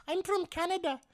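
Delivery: very high-pitched mock female voice; squeaky-high retold chime-in; imitated lady. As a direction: pipe up as the lone Canadian lady. Imitates the one lady answering.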